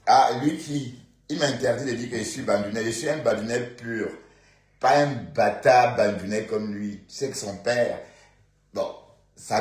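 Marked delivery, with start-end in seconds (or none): 0.1-7.0 indignant outburst. Real, not acted. Indignant rant about rival.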